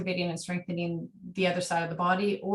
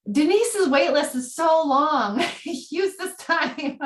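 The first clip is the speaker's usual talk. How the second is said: loud, high and laughing, drawn out; high-pitched, giggly urging; laughing exclamation; 8 semitones higher, 6 dB louder, much slower than usual. Real, not acted. Urging group to ask questions.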